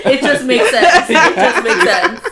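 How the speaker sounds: loud laughing exclamation. Real, not acted. Friends joking about a character smoking, loud laughing.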